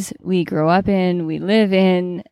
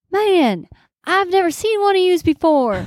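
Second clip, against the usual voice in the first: high, astonished voice cracking; amazed driver; 12 semitones higher than usual. Retold or imitated. Driver amazed to see a nun, pitched high. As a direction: gasp it out in disbelief. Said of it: "And he's like".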